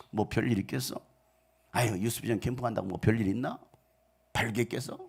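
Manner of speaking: low, soft dismissive grumble; dismissive doubter. Acted. Doubter shrugging off the camp, dismissive. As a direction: shrug it off under your breath.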